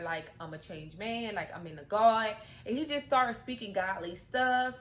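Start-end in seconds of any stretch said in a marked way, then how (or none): none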